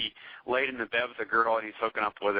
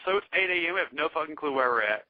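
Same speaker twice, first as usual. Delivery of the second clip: usual speaking voice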